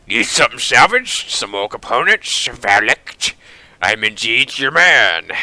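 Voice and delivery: put-on old German junk dealer pitching his trade; accented old man offering his services; gruff well-meaning German. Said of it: "He speaks with a gruff but well-meaning tone".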